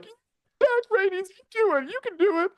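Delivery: very high falsetto encouragement; mock cheering. Viewer urging on a doomed heroine, mock falsetto cheer. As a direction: cheer her on in a tiny, squeaky voice. Acts out own cheering while watching.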